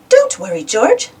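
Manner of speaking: high, bright reassurance; mother cheering up her sad son, bright; brightly. A narrator's reading. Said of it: "says Mummy Pig brightly".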